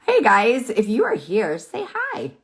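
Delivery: high-pitched call-out to viewers; voice up an octave. Real, not acted. Asking viewers to say hi, in a voice jumping up high. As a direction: let the voice leap way up, highest on the last words. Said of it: "my voice goes up an octave".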